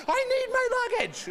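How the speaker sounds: very high comic wail; hammed-up high-pitched plea; desperate squeal. Comic outburst over luggage.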